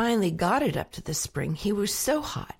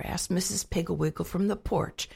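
ordinary delivery

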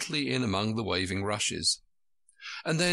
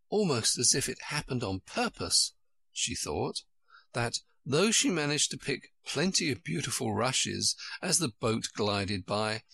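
plain reading voice

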